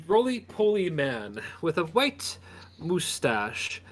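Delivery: usual speaking voice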